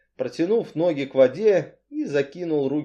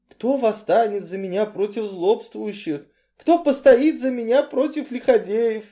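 high, sustained lament; psalm-like plea; 9 semitones higher, 3 dB louder than usual. A narrator's reading. Cain pleads in psalm words, plaintively. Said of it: "тихо спросил еврей словами псалма".

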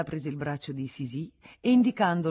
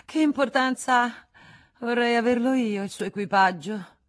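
high, loud, impulsive outburst; exclaimed longing; 7 semitones higher, 8 dB louder than usual. A narrator's reading. Marescialla wishing for the carriage, exclaiming with longing. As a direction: burst out with envious longing.

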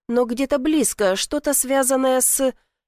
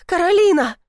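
громкий высокий зов; выкрикни имя подруги; крик; 9 semitones higher, 5 dB louder than usual. A narrator's reading. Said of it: "крикнула". (отчаянный крик)

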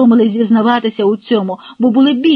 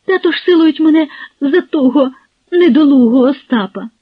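high, louder voice breaking into tears; crying; 7 semitones higher, 6 dB louder than usual. A narrator's reading. Girl telling her lover she's forced to marry, crying. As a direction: sob out the injustice.

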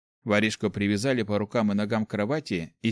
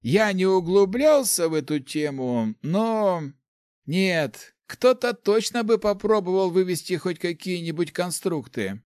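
put-on high-pitched lively old mage; Lars, elderly mage; 8 semitones higher, slower than usual. A narrator's reading. Old mage admitting ignorance to his colleague, high and animated. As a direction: voice an old teacher in a raised pitch.

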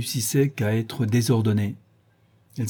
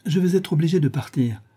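sudden higher exclamation; exclaiming; 6 semitones higher than usual. A narrator's reading. Lady Henry announcing her departure, a higher exclaiming voice. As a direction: blurt it out, pitched up. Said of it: "s'exclama Lady Henry".

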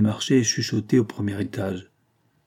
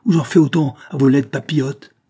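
louder, higher angry threat; exasperated threat; 4 semitones higher, 7 dB louder than usual. A narrator's reading. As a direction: threaten her to get out. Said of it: "reprit la jeune fille exaspérée".

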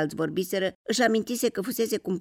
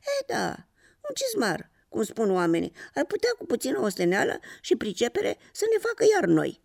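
higher-pitched, wistful character voice; bitter smile; 7 semitones higher than usual. A narrator's reading. Old slipper to its mate about being mended, bitter smile. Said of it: "zâmbind cu amărăciune".

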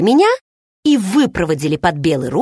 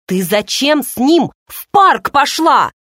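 exclaiming; 6 semitones higher, 4 dB louder, slower than usual. A narrator's reading. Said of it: "воскликнул Керри".

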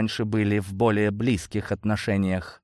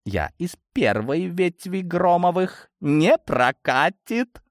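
higher, slow, drawn-out gloating; gloating purr; 7 semitones higher, slower than usual. A narrator's reading. Blonde dismisses Osokin's treaty, purring with malice. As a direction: purr it slowly, relishing the refusal. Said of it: "злорадно промурлыкала блондинка".

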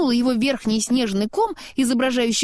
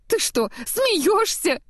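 high, drawn-out, delighted disbelief; exclaiming; 10 semitones higher, slower than usual. A narrator's reading. Happy snowman answering Anna's worry, excited exclamation. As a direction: exclaim in a high, stretched character voice. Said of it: "воскликнул он".

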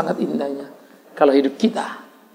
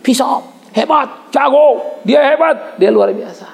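mock bragging, slow and higher; hammed-up self-praise; boastful chest-thumping; 5 semitones higher, slower than usual. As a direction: brag about yourself mockingly. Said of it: "menepuk dadanya dan berkata".

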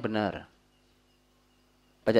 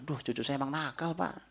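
complaining grandparent; 3 semitones higher, 9 dB quieter than usual. Retold or imitated. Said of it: "jangan kalimatnya".

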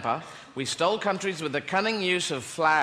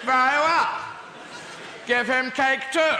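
pompous inquisitor; 7 semitones higher than usual. Retold or imitated. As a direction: announce it with mock grandeur. Inquisitor grants cake again.

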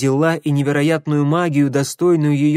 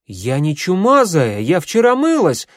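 loud, high outraged yell; offended shout, rising to a shriek; 7 semitones higher, 6 dB louder than usual. A narrator's reading. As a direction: yell it back, pitch climbing sharply. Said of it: "заорала Гвен".

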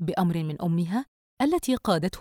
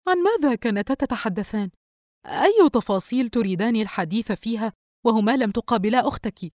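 higher, astonished questioning; puzzled wonder; 4 semitones higher, 4 dB louder than usual. A narrator's reading. Mother closing door, asking daughter about details, astonished. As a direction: ask it bewildered, voice lifted. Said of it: "الأم بتعجب".

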